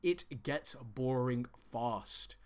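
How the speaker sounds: drawn out word by word; slow, emphatic repeat. Real, not acted. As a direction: spell out the warning very slowly, each word weighted.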